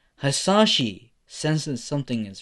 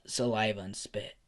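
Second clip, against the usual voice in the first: usual speaking voice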